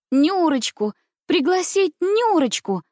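high, delighted exclamation. A narrator's reading. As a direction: cry out gladly at a sudden idea. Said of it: "обрадовалась я". (радостно, высоким голосом)

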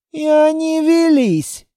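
astonished that it worked; amazed. A narrator's reading. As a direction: ask in open disbelief. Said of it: "поразился длинный".

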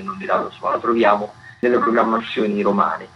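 normal talking voice

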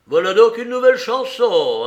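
loud, high crow of victory; triumphant. A narrator's reading. The cook triumphs as the crew acclaims him, triumphant crowing. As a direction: exult at the change of tune. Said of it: "triompha le con-, coq".

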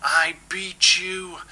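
bellowing. Acted. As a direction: roar out the victory slowly. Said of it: "bellowed at the oily gyre".

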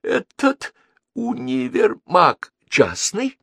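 high-pitched, courteous inquiring diplomat; eager foreign visitor's high question; polite insistent curiosity. A narrator's reading. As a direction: ask politely but keenly, pitched well up. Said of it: "вежливое, но настойчивое любопытство".

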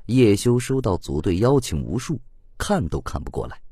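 ordinary delivery, nothing marked

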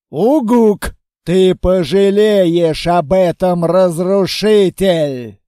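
loud, slow inhuman monster growl; monster threat. Acted. Monster warning the destroyer, loud slow growl. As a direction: roar the threat down at the enemy.

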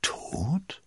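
slow, hushed question jumping up at the end; pause, then breathe the last word upward; disbelieving. A narrator's reading. Cardinal checks that Milady is dead, disbelieving. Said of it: "wiederholte der Kardinal ungläubig".

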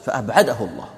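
normal talking voice

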